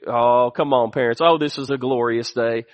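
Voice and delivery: low-pitched, softening "oh, come on"; low, savoring aside. Real, not acted. Preacher savoring a glorious day with parents, low and relishing.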